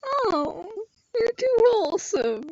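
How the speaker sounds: small, high weepy whimper; high, soft tearful voice; crying without tears. Real, not acted. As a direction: whimper it softly, touched. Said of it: "I'm crying now".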